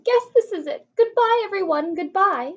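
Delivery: high, loud, overblown goodbyes; theatrical last goodbye as they fall; melodramatic farewell. Acted. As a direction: ham up a doomed farewell. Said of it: "being so dramatic".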